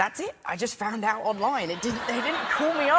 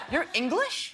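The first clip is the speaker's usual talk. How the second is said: higher-pitched surprised question; mock surprise, pitched up; surprised fan; 6 semitones higher, slower than usual. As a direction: mimic a fan taken aback. Retells surprised fans' reaction to him.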